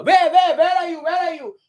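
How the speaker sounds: high, loud searching holler; Lancelot yelling. Acted. Lancelot pretends not to see Lorenzo, yelling. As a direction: call out as if unable to see anyone. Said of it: "So he just goes on yelling".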